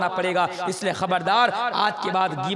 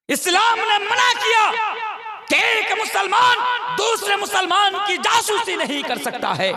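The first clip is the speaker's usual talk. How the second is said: forceful preaching; 11 semitones higher, 4 dB louder than usual. Real, not acted. Preacher rising to a forceful point.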